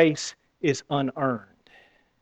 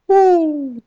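high, quick whoop of joy; a preacher who can't hold in his delight; excited whoop; 13 semitones higher, 4 dB louder than usual. Real, not acted. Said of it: "That about made me wanna jump".

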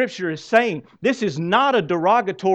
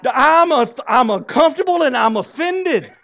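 high, loud mocking voice of prissy church folk; mock offended; 6 semitones higher, 7 dB louder than usual. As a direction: play the scandalized religious crowd, higher and louder. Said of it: "the religious people are going".

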